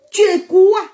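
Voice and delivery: high, loud challenge; challenging shout. Real, not acted. To a doubter online, a loud high challenge. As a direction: throw it at them, loud and high.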